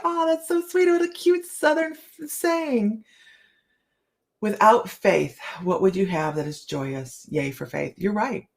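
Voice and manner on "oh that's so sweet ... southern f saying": high, gushing naive voice; mocking gushing. Host imitates naive people gushing over the saying, high and cooing. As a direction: coo it like someone charmed by the phrase. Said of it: "people are like".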